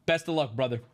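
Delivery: low-voiced dealer, lightly imitated; retold dealer's low, casual aside; amused dealer. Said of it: "kinda laughing".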